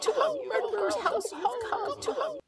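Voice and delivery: high, loud eerie chanting chorus; sing-song taunting chant of creatures; overlapping high mocking chant with cackles; raspy chorus of birds. A narrator's reading. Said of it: "their raspy voices ringing out together in a horrible chorus".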